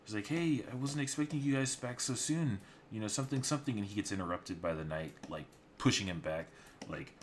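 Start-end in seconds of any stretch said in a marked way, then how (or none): 0.3-3.8 a much higher, louder eager barkeep. Retold or imitated. Barkeep greeting the returning knights, high and eager. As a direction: rush out fawning over them.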